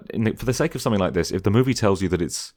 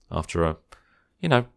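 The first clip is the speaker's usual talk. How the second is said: usual speaking voice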